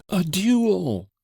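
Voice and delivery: high, loud yell of a duel. Acted. Old father yelling at his son, breathless shout. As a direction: spit the word out, breathless. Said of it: "yelled the old wretch".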